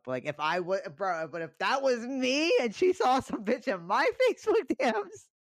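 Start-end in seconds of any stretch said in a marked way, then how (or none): 1.4-5.3 incredulous squawk with a laugh breaking in; indignant outburst. Real, not acted. Telling friend about the double standard, loudly indignant. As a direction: voice climbing very high and loud.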